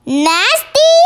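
high, incredulous exclamation; indignant cry. A narrator's reading. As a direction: cry it out as an offended protest. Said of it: "cried the B F G".

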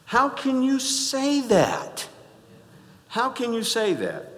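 high, loud, slow disbelief; incredulous. Real, not acted. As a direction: cry out how could you. Preacher exclaims in disbelief.

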